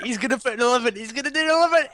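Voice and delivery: high, rising mock excitement; retold excited guess. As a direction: replay your giddy prediction, pitch climbing. Retells own excited guess while laughing.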